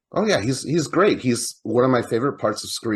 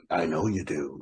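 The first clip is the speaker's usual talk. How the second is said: voice-changer killer; 5 semitones lower, 6 dB quieter than usual. Retold or imitated. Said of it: "voice changers or anything like that".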